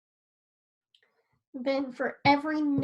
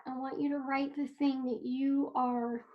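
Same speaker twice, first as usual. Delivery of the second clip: usual speaking voice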